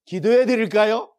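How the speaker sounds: strong, rising offer to pray; loud model line. Shown as a demonstration. Preacher models the phrase for the congregation, loud rising. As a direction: call it out for the congregation to repeat. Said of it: "이런 말을 따라해 보세요".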